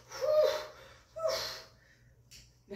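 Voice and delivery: very high puffed-out whew; hammed-up blowing out of breath; mock exhausted whew. Puppet finishing his pretend workout, exaggerated puffing whews.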